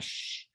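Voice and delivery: soft unvoiced shh; shush. Shown as a demonstration. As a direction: hush the room. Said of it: "people do shh for quiet".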